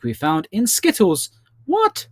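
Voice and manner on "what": very high-pitched "what?"; incredulous. Acted. Quiz host learning what is in Skittles, incredulous. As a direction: squeak it out in disbelief. Shocked aside while reading the answer.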